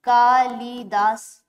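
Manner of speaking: Kalidas drawn out slowly; slow dictation. Shown as a demonstration. Teacher writes the name for students, slowly. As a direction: stretch Kalidas syllable by syllable. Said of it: "बताया कैसा".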